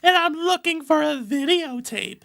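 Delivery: put-on high-pitched girly voice; squeaky high girl.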